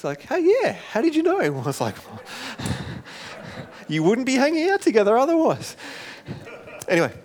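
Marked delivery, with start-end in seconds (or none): 0.2-1.4 very high, mock-astonished squeal; amazed youngsters. Imitates surprised youth group reply. 3.9-5.8 high-pitched, mock-incredulous answer; comic retort. His comic retort to youth group.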